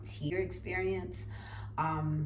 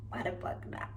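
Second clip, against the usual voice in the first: laughing; 3 dB quieter than usual. Real, not acted. Repeat through laughter.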